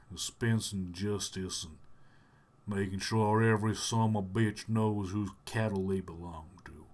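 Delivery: low Southern cowboy drawl; Sam Elliott Southern drawl. Acted. Cowboy riding off to dispense justice, in a Southern drawl. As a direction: drawl it like a Western hero. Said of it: "Sam Elliott kinda drawl".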